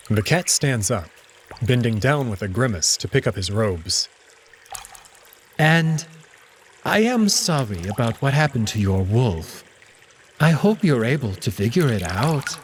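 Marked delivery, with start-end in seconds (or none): 5.6-12.6 a grudging goodbye as the cat; Alex, apologetic cat. Acted.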